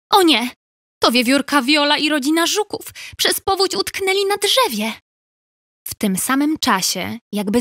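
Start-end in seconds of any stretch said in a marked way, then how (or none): 0.1-4.9 alarmed cry. Acted. Beaver sees stranded friends.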